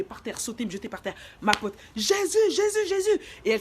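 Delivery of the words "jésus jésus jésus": high, loud repeated wail; imitating screaming friend. Stoned friend calling on Jesus, acted high scream. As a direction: shriek the name over and over. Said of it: "elle criait grave".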